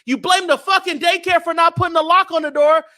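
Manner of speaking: high, loud, heated argument; exasperated shout. Real, not acted. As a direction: shout the point home in outrage. Heated argument about blame.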